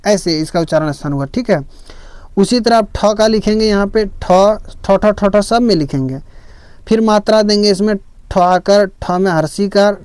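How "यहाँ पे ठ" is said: ordinary talk, nothing marked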